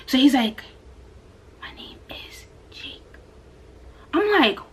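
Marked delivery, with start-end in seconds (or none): 1.6-3.0 faint unvoiced whisper, slow. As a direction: breathe the name out like a shy student. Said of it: "'cause you're whispering".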